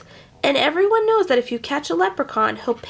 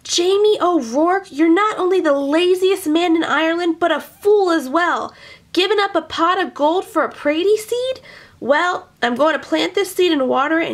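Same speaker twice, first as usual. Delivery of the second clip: furious wife; 6 semitones higher than usual. Acted. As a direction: tear into your lazy husband. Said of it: "she was furious".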